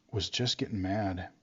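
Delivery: ordinary talk, nothing marked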